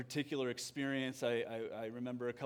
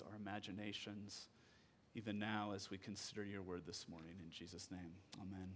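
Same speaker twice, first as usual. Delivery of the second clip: quiet prayer; 3 semitones lower, 6 dB quieter than usual. Acted. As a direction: pray gently and unhurried, voice sinking. Pastor leads prayer after reading.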